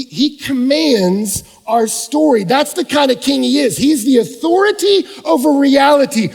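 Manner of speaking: loud, high, emphatic sermon; full-force preaching. Acted.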